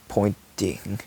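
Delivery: ordinary delivery, nothing marked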